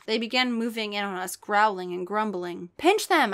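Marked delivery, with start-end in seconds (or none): 2.8-3.3 loud, high shout. Acted. Narrator shouting to her family, loud. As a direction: yell the order to the family. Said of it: "I shouted to my family".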